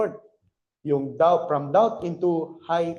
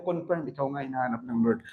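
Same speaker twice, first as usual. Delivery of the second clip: lower, softer reassuring leader; leader's reply; 5 semitones lower, 6 dB quieter than usual. Pretend. Leader telling a doubter he is chosen, lower and calm. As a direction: calmly confirm as the leader. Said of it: "Sabihin sa'yo ng leader mo".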